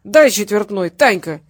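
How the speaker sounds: put-on loud, insistent drunken holler; drunk demand. A narrator's reading. Drunk father demands money from his daughter, loud and insistent. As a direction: demand it loudly, like a boozy father.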